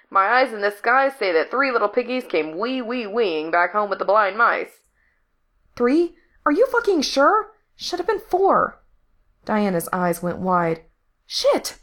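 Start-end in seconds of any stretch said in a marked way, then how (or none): 0.1-8.8 sing-song mockery, rising into high disbelief; brash radio operator. A narrator's reading. J D reports the count to Diana, brash and incredulous. 11.3-11.8 sharp high hissed curse. A narrator's reading. Diana learns a kid is missing, hissed. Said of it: "she hissed".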